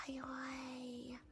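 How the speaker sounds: soft unvoiced whisper. Real, not acted. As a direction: breathe the greeting softly. Quick greeting to viewer.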